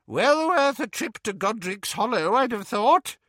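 put-on high, gossipy old woman voice; fussy old aunt. A narrator's reading. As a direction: play a nosy old aunt.

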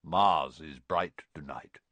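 low, slow centaur voice; deep, unhurried plain statement; simply said. A narrator's reading. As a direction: state it simply, without explanation. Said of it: "he said simply".

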